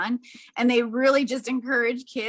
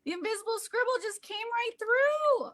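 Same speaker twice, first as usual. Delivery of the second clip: delighted wonder; 11 semitones higher, 5 dB quieter than usual. Real, not acted. Demo of scribble appearing.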